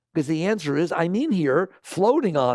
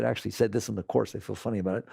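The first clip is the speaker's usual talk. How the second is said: softer and lower, an aside; quiet aside; 7 semitones lower, 6 dB quieter, faster than usual. Real, not acted. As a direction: drop your voice for a private aside.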